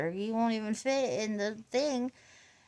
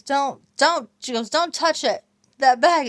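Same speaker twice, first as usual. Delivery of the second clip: imitating mom's alarmed warning; 4 semitones higher, 10 dB louder than usual. Mom warning in retold story.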